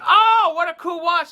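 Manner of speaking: high, loud mock-impressed exclaim; mock admirer. Imagined friend admiring the watch, loud high mock gush. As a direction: ham up a friend gushing over the watch. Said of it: "they're not gonna say".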